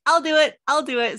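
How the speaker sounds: high, loud eager yes. Retold or imitated. To media requests, an eager loud yes. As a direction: jump at the chance, keen and loud.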